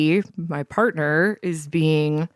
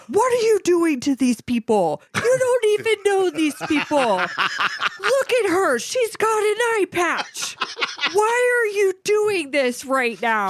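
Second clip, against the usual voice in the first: hammed-up shrill sister voice; scolding sister; 13 semitones higher than usual. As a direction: scold her like an exasperated sibling. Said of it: "her sister wanders over and is like".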